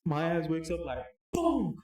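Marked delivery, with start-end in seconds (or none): 1.3-1.8 high, loud comic bang; exclaimed sound effect; impact imitation. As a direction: punch it out like a sudden crash. Imitating head hitting metal frame.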